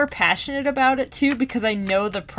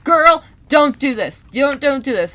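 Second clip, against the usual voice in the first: raised, higher urgent plea; retold yelling; 5 semitones higher, 6 dB louder than usual. Listener yelling at the audiobook heroine, pleading. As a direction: plead loudly, drawing it out. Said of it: "yelling at the audiobook".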